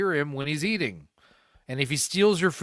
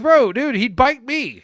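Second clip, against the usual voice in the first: exasperated mock retort; 9 semitones higher, 9 dB louder, slower than usual. Retorting to friend about the dog.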